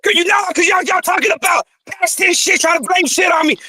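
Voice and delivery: real angry shouting; yelling. Real, not acted. Man rejecting blame for past events, angry shouting. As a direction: shout your defence over everyone. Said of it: "just chill out with all that yelling".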